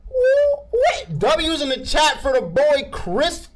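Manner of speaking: high, loud cheer; excited cheering. Real, not acted. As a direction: hype up the chat. Cheering for donor.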